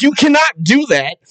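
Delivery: high-pitched mock scolding; mild mock yell; retold yelling. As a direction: imitate adults yelling at a kid. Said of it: "like, yelling and screaming".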